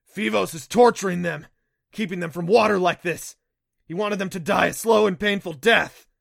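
loud, high, outraged shouting; furious Kelpie outburst. Acted. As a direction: rage at the cruelty, full voice. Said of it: "Neff was furious".

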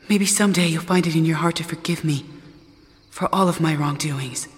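ordinary delivery

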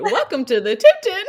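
chirpy hotel greeting, laughing; Disney sitcom voice. Retold or imitated. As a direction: imitate the Zach and Cody opener. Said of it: "It sounded the exact same tune".

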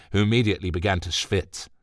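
usual speaking voice

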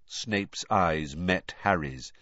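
ordinary delivery

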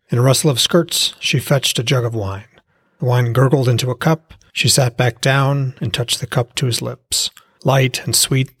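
usual speaking voice

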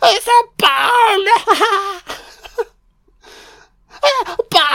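high, loud squeal breaking into laughter; squealed line collapsing into cackles; hysterical laughter. Acted. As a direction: shriek it, cracking up helplessly. Repeats film line, cracking up.

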